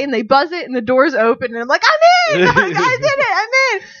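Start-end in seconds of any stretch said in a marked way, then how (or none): 1.8-2.5 much higher, louder, laughing "I'm in!"; triumphant squeal. Retold or imitated. Traveller celebrating getting through the hostel door, squealing. Sneaking into locked courtyard. 2.8-3.9 triumphant squeal. Retold or imitated. Traveller celebrating getting through the hostel door, squealing. Sneaking into locked courtyard.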